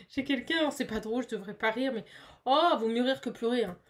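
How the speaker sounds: ordinary delivery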